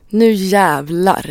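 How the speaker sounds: slow, drawn-out resolve; determined mutter. Acted. Imagined vandal gearing up to act, slow and determined. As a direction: psych yourself up slowly, savouring the words.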